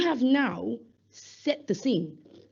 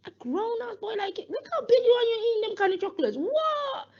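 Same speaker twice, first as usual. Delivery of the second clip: higher, playful mock disbelief; mock teasing; 12 semitones higher than usual. Youth worker teasing a big lad, pitched-up mock disbelief. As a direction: rib him about the chocolates. Teasing the boy about chocolates.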